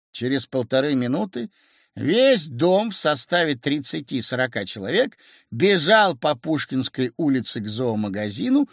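plain reading voice

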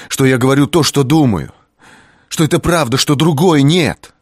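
loud, high insistent; urgent plea. A narrator's reading. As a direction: press hard to convince. Kris pleading loudly.

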